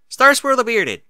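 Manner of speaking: high, loud, triumphant announcement; triumphant declaration. Acted. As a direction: proclaim the answer like a victory. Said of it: "she declared triumphantly".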